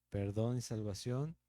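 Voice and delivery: usual speaking voice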